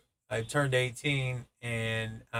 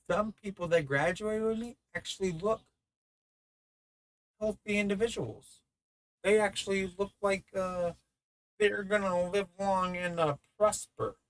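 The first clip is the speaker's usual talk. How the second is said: a hint of high, creaky old man; mock old man voice; 8 semitones higher than usual. Pretending to be eighty at the reunion, lightly high voiced. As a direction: play a frail old-timer marveling.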